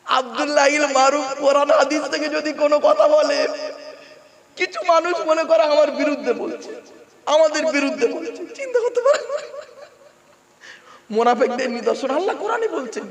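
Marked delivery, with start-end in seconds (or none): 0.1-8.3 high, loud sermon call; ringing preacher's exhortation; preaching at full force. Acted. Preacher raising his voice. 8.3-9.2 laughing disbelief. Real, not acted. Incredulous laughing aside.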